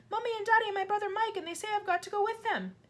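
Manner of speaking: put-on high, breathless distressed child; a girl blurting bad news; girl crying out. A narrator's reading. Child explains to teacher.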